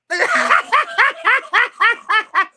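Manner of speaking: high, loud gloating cackle; gloating laugh; loud triumphant laugh. Real, not acted.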